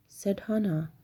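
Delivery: ordinary delivery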